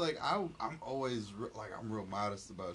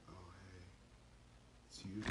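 awkward understated greeting; 4 semitones lower, 15 dB quieter, faster than usual. Pretend. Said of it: "I'd be like".

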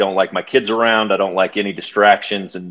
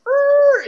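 high mock record-scratch squeal. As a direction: imitate the crowd's stunned screech. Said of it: "everybody was like".

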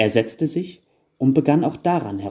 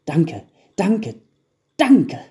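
high thanks leaping higher and louder; children calling out; 7 semitones higher, 6 dB louder, much slower than usual. A narrator's reading. Children thanking the carpenter, calling out. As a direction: build to a high, loud final cheer. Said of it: "riefen die Kinder".

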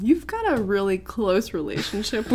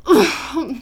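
slow, loud, higher groan of defeat; saddest semi-angry voice; 6 semitones higher, 6 dB louder, much slower than usual. Retold or imitated. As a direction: drag it out, crushed and cross. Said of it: "the saddest, like, semi-angriest voice".

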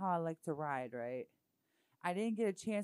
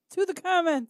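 loud, higher-pitched call to the audience; raised voice, rallying the comments; 8 semitones higher, 9 dB louder than usual. Real, not acted. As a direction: throw it out loudly to the viewers. Calling viewers to the comments.

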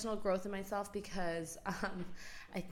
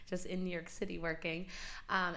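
usual speaking voice

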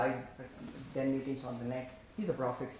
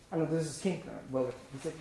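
ordinary delivery

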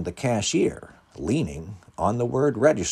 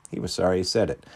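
ordinary delivery

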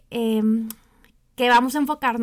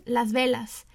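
ordinary delivery

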